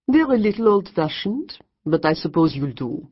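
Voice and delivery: put-on condescending French accent. A narrator's reading. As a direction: dismiss her paintings in French tones. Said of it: "the woman said".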